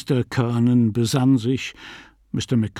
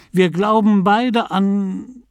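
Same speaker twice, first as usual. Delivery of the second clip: high, slow, left hanging; slow down and break off searching; hesitating; 9 semitones higher, 5 dB louder, slower than usual. A narrator's reading. McCoy searching for common ground, hesitant. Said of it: "er zögerte einen Moment".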